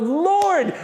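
high, urgent plea to God; acted-out desperate prayer; crying out. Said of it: "crying out to God".

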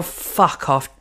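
ordinary talk

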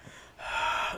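unvoiced breathy exhale heard in the dark; imitated eerie sigh. Imitates the sound heard above head.

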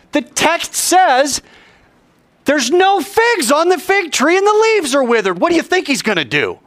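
high-pitched, impassioned preacher; full-force preaching. Real, not acted. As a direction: build amazement at the revelation, voice soaring.

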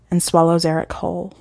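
usual speaking voice

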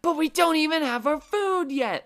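high, loud entitled whine; shrill protest at the manager; complaining whine. Retold or imitated. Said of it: "Ellen complained at the guy".

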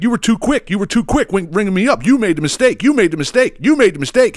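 high, insistent mock rant; retold screaming customer. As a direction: imitate an angry customer hammering the accusation. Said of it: "he's actually screaming about it".

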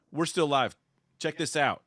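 usual speaking voice

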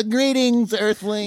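high, booming space alien voice; alien greeting. Pretend. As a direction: greet the humans like a visiting alien. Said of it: "It's something like that".

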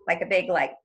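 ordinary talk, nothing marked

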